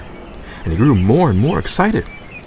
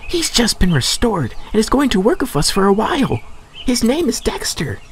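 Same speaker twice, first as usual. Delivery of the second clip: higher, matter-of-fact message; Percy voiced; 13 semitones higher than usual. A narrator's reading. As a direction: pass on the boss's order. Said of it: "replied Percy".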